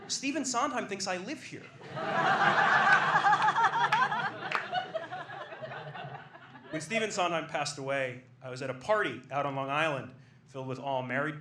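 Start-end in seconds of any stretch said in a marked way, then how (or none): 1.7-6.7 loud, high rising laugh; a full loud laugh that keeps climbing. Real, not acted. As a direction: lose it completely. Laughing at own punchline.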